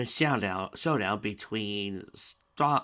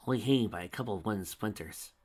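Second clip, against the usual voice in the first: ordinary delivery, nothing marked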